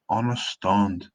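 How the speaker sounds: slow Scots "understand" with a broad last vowel; Scots pronunciation. Shown as a demonstration. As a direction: draw out "understand" as broad "unnerstaund". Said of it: "is pronounced slightly differently".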